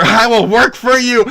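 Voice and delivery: high, loud desperate plea; pleading yell. Pretend. Streamer begging for voice work, loud pleading yell. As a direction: belt it out, pitch leaping up. Repeats plea much louder and higher.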